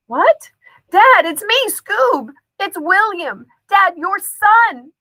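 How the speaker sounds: high, frantic pleading; terrified boy begging his dad to see him; panicked. A narrator's reading. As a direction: grab him back with your voice.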